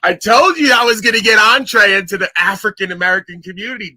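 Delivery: loud, triumphant crowing with a catch in the voice; excited shout. Real, not acted. Streamer gloating to his chat about his breakthrough, shouted. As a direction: bellow it out like a victory lap.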